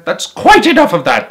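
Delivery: loud, high stern call; shout. A narrator's reading. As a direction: shout to break up the crowd. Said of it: "shopkeeper shouted".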